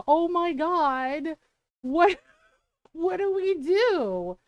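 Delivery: slow, laughing what do we do; panicked question, laughing. Retold or imitated. Quoting their panic.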